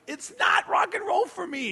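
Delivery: high, loud exasperated shout; retold shout. Comedian yelling at Lars he won't be introduced. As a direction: yell it back at him in disbelief. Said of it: "And I'm like".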